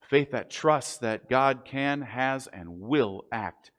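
slow and deliberate. Real, not acted. Preacher stresses God's faithfulness.

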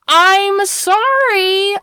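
high, very slow sarcastic I'm sorry; a drawn-out, sarcastic I'm sorry; exaggerated mock apology. Real, not acted. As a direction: stretch out the fake apology. Sassy rant at the townspeople.

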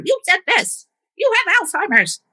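hammed-up gotcha at mom; mock accusing. Daughter scolding mom about memory, mock accusing high voice. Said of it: "my choice to point it out".

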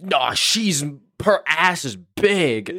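mock one-upping boast, pitched high; one-upping friend. Imitating friends one-upping each other about girls, mock boastful. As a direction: imitate a friend topping the last brag, drawn out at the end. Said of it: "someone would have to one up them".

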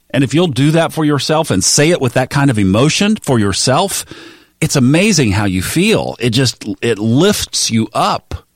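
ordinary delivery

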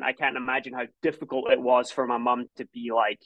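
ordinary talk

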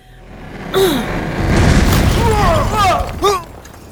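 long, loud, high cry of effort; full-force battle scream; power scream. Acted. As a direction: scream as the heat erupts. Blowing the door off.

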